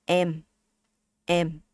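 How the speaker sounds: "M" short with flat relaxed tongue; Vietnamese M. Shown as a demonstration. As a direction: say "M" short, straight and clear. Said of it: "more Vietnamese accent".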